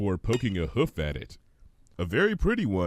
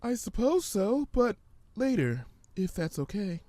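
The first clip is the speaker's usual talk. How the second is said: much higher, gentle pony voice; Fluttershy; 11 semitones higher than usual. Acted. Fluttershy answering the hummingbird, high and gentle.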